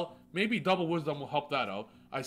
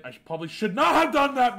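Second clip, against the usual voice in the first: frustrated outburst; 8 semitones higher, 8 dB louder than usual. Real, not acted. Frustrated self-blame after misplay.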